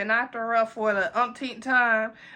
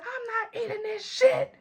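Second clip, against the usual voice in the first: much higher, whiny tipsy rant; drunk tantrum; 10 semitones higher, 3 dB quieter than usual. Retold or imitated. Refusing the friend's hash browns, high whiny drunk tantrum. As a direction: throw a squeaky childish tantrum. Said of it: "I'm just going in".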